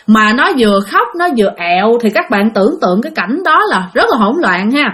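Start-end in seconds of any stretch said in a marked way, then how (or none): none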